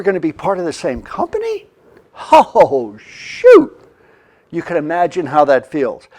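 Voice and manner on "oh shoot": hammed-up groan of despair; dismayed groan. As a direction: wail it in mock dismay. Reaction to merger.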